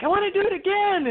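high, fast and eager; gushing like a keen recruit. Retold or imitated. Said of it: "I'm like".

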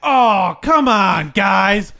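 high, loud mock protest; protesting whine. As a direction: plead loudly like a busted suspect. Retold suspect caught by police.

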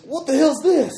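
high squeaky dude; goofy character voice. Acted. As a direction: squeal it in a silly voice. Character voice in roleplay.

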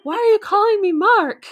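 high, loud, botched attempt at Steven's accent; confused high-pitched bad accent. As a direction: overdo his accent in loud bewilderment. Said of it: "I can't even do his accent".

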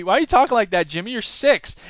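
ordinary talk

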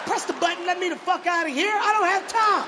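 high, rising impatient yell; irate demand. Acted. Driver trapped by parking attendant, high irate yell. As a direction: demand out at the top of your voice.

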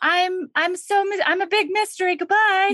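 high, breezy, fast mock coyness; coy goddess. Goddess dodging friends' questions, high breezy mock coyness. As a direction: brush them off sing-song. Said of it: "And she's like".

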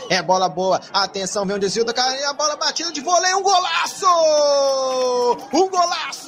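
rising to a screaming goal call; explosive goal commentary. Real, not acted. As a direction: build up and explode on golaço. Goal scored, commentator erupts.

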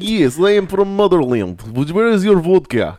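high, loud hammed-up Russian accent. Costumed border agent fooling partygoers in a hammed-up Russian accent. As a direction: play the proud Russian, thick Russian accent. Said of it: "talk in the Russian accent".

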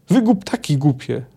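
mild narrated yell of exasperation; understated angry holler; scolding yell. A narrator's reading. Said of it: "wrzasnęła z trzaskiem".

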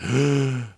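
mock gasp of a hushed crowd; imitated shocked gasp. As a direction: gasp as if the room is scandalized. Mimics the room's shocked reaction.

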